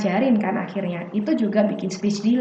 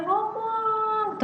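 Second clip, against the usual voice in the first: high, long-held vowel on one note; endlessly drawn-out word; 12 semitones higher, 6 dB quieter than usual. Retold or imitated. Teacher mocking parents who talk nonstop, droning it out. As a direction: stretch it out as if it never stops.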